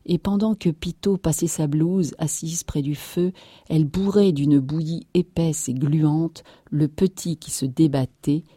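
ordinary delivery, nothing marked

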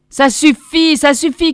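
high, loud outburst of exasperation; exasperated cry. A narrator's reading. Crowd member stopping the happy prisoner's tale, crying out exasperated. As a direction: cut in loudly, at the end of your patience. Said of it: "cria quelqu'un exaspéré".

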